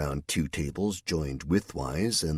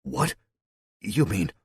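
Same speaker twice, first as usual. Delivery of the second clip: higher squeak of disbelief; shocked squeak; 7 semitones higher, slower than usual. Acted. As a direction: blurt it out half-strangled with shock. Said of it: "Joe in shock squeaked out".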